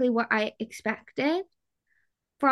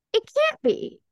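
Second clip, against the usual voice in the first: mock high; 4 dB louder than usual. Real, not acted. Joking agreement, high pitched.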